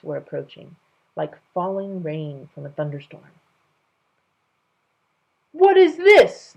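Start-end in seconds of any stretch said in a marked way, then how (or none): 5.5-6.5 loud, slow, high royal demand; king demanding an answer, loud and slow. Acted. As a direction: boom it out like an affronted king. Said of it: "the king demanded".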